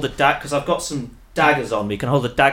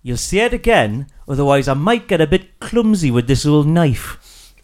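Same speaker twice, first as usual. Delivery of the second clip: put-on gruff thick British accent, menacing; 3 dB louder than usual. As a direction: lean into a thick accent while threatening. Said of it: "such a thick accent".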